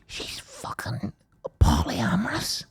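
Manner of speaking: slow, very soft whisper; imitated friend's hushed disclosure; retold whispered revelation. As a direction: whisper the news, spacing it out.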